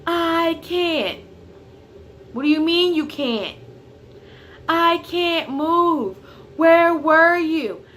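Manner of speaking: high, loud mock of mom yelling, dragged out slow. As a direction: play the mom shouting back through the door, slow. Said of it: "She's yelling".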